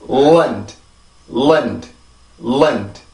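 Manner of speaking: Lund as "lund", long L, reduced vowel; small-beat syllable. Shown as a demonstration. Teacher drilling island's second syllable, Lund with reduced vowel. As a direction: say Lund as one falling beat, light final D. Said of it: "리듬을 만들어 봅시다".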